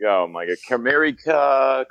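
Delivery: ordinary talk, nothing marked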